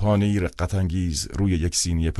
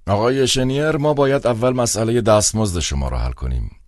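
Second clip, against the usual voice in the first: put-on higher, louder, cold old man's tone; 7 semitones higher, 4 dB louder than usual. A narrator's reading. Old director naming the fee to the clown, cold and aloof. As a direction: speak as a cold, calculating old official. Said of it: "با صدایی پیر و سرد".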